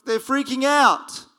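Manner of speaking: mild freaking out. Retold or imitated.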